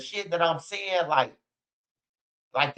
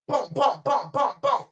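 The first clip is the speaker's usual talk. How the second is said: imitated gunshots; 7 semitones higher than usual.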